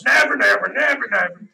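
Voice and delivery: a hint of a menacing brute; monster voice. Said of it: "the monster that grabs you and just goes".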